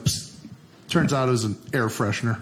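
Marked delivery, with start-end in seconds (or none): 0.0-0.3 hiss psst. Retold or imitated. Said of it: "It was like".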